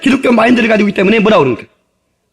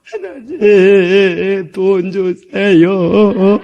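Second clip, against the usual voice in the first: mock begging prayer; 2 semitones lower, much slower than usual. Shown as a demonstration. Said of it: "이렇게 구해보면 얼마나 멋있어요".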